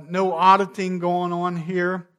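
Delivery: ordinary talk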